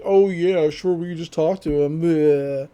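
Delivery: put-on dismissive sarcasm, ending in bleh; sarcastic mocking. [name] dismisses talking to the ghosts, sarcastic. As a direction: scoff at the idea in a mocking voice.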